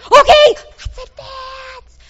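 high, loud rallying command; commander's call. Acted. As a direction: call the troops forward with a big high voice. Leading congregation march, Joshua voice.

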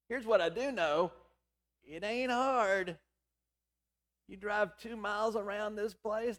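usual speaking voice

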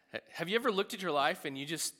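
normal talking voice

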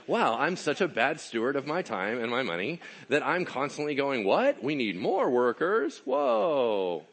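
overacted dumbfounded amazement; mock astonishment. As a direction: play a clueless boss amazed at his own mistake. Acts out a clueless, astonished vineyard owner.

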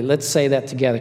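ordinary delivery

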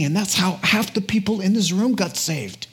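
ordinary talk

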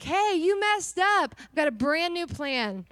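high, loud, drawn-out call quoting the Lord; exuberant announcement. Retold or imitated. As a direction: call it out slowly like great news. Voicing the Lord's cheerful reassurance.

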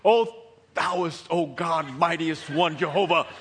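loud, grandiose prayer parody; mock religious. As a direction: declaim it like showy piety. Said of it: "one of these religious".

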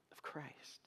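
hushed whisper on the final words. Real, not acted. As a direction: drop to a whisper. Whispered climax of sermon point.

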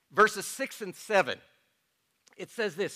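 ordinary delivery

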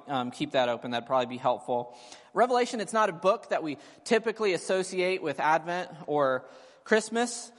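ordinary talk

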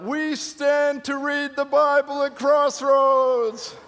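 high, loud, drawn-out yell. Retold or imitated. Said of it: "a lady yelled out".

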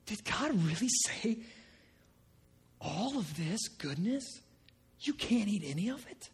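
a hissing tempter planting doubt; sly serpent. Acted. Preacher voices serpent's doubting question.

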